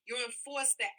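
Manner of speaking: ordinary talk, nothing marked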